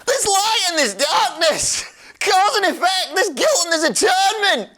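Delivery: a high, theatrical put-on voice for the game line; mock-dramatic villain read, pitched high; hammed-up quote reading. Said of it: "can I have it again?".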